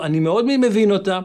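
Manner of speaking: ordinary talk, nothing marked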